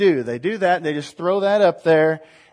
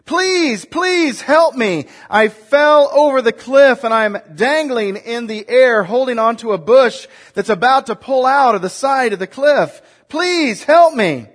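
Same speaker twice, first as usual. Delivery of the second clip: desperate pleading; 7 semitones higher, 7 dB louder than usual. Acted. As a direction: beg for your life from the cliff. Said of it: "The tourist pleaded".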